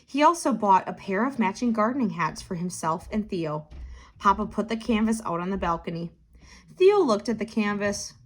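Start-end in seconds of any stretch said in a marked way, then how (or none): none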